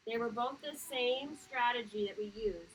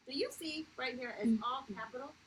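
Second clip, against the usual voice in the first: ordinary talk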